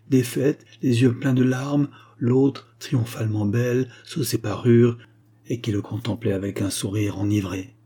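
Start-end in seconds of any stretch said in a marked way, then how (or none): none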